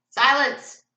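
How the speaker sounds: loud, high shouted order; commanding roar. A narrator's reading. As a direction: roar for silence. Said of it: "commanded in a terrible roar".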